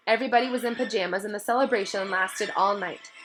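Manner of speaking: usual speaking voice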